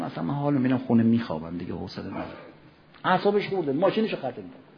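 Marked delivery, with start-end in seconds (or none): 0.0-2.3 quick, much softer, fed-up mumble; grumpy sulking. Retold or imitated. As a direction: brush it off, sulking and low. Grumpy man after car scratched.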